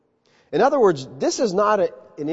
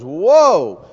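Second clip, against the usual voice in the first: loud astonished whoa; amazed whoa; 8 dB louder than usual. Acted. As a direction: gasp it out in wonder. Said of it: "Peter's looking at this stuff and he goes".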